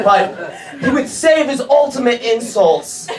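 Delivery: ordinary talk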